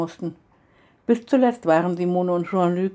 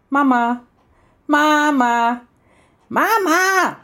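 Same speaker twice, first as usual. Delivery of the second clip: high, loud call for Mama, building; brüllendes Rufen; 8 semitones higher, 5 dB louder, much slower than usual. A narrator's reading. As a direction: yell through the house, stretching it out more each time. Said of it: "brüllte es quer durch das Haus". (lautes Brüllen quer durchs Haus)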